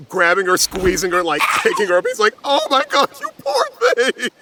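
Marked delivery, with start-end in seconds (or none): 2.4-4.3 much higher, voice cracking with sobs; hammed-up tearful father; overblown comforting dad. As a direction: gush over the poor thing. Father comforts Velma, acted.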